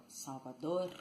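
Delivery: "Salvador" with a tongue-tip final R; full final R. Shown as a demonstration. Teacher contrasting regional versions, "Salvador" with tapped tongue-tip R. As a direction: vibrate the R that ends "Salvador". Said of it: "So instead of saying".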